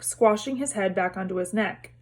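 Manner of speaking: ordinary delivery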